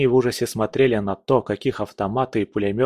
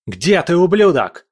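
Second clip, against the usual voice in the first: loud, high-pitched angry shout; furious yell; 7 semitones higher, 6 dB louder than usual. A narrator's reading. Narrator as hero hunting the fleeing prince, furious yell. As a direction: yell it out, voice jumping up. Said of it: "заорал я".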